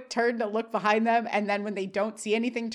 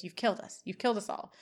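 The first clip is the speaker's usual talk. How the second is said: understated accusation; 8 dB quieter than usual. Retold or imitated. Retold grim accusation.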